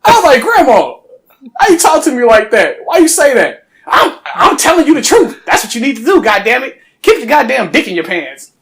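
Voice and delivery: high, loud, indignant back-and-forth; shocked protest. Retold or imitated. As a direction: protest loudly to grandma. Arguing with grandma, shocked.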